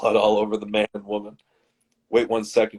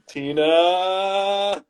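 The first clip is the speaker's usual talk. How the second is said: drawn-out, higher mock cry; alarmed cry; 9 semitones higher than usual. Werewolf Alan to vampire Tina, drawn-out alarmed cry. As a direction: call the name out long and high.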